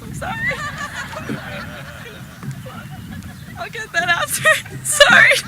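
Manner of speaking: embarrassed giggling apology; laughing through a flustered sorry. Real, not acted.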